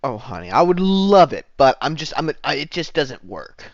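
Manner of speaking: gush, then falter into an excuse; bartender. Acted. Bartender apologetically refuses the performer, gushing role voice. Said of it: "the bartendress says".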